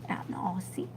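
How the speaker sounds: out in Aussie repeated as a voiceless whisper; whispered echo. Real, not acted. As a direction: echo out in Aussie softly to yourself. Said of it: "whispered echo".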